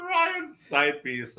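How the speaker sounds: high, slow, laughing tease. Real, not acted. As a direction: drag it out through a laugh. Teasing with a laugh.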